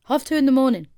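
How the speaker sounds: half two in the morning, stunned and loud; incredulous exclamation. Real, not acted. Repeats the time in disbelief.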